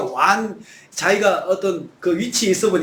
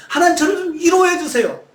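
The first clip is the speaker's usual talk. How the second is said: pleading cry; 11 semitones higher than usual. Acted. Preacher as David begging God for comfort. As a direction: beg God for comfort, stretching 위로해.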